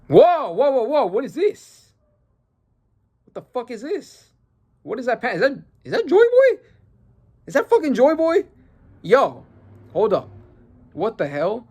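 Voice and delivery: high-pitched shocked disbelief; stunned reaction. Real, not acted. Reader stumbles on Joy Boy in the panel, stunned. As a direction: blurt it out high, incredulous.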